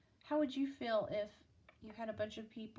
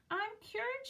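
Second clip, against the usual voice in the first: much higher joyful shout; 11 semitones higher, 3 dB louder than usual. Acted. Girl celebrating her cure, joyful shout. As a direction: cry out in delight at being cured. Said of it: "she shouted".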